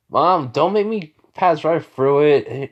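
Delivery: acted son warning through a door; exasperated warning. Son at mom's locked door, exasperated warning.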